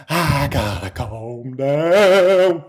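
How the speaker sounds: very slow, stretched-out words; strained drawl. Real, not acted. Streamer failing to calm down, drawn-out self-talk. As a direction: drag out each word as the excitement creeps back.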